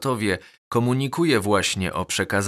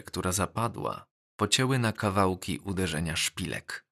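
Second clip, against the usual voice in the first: plain reading voice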